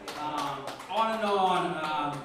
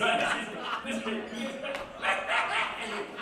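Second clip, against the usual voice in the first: breathy laugh; airy laugh; 6 semitones higher than usual. Real, not acted. Poet laughing at his Whitman joke, airy laugh. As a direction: laugh at your own joke.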